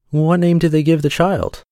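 high-pitched inquisitive mouse; storybook mouse wanting to know; curious mouse. A narrator's reading. Curious mouse questions the cat.

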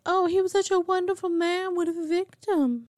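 high put-on voice mocking praise; mock psychiatrist voice. Podcaster mocks the psychiatrist praising Rock, high put-on voice. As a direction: sneer it in a high fake voice. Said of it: "I don't know why he has an accent".

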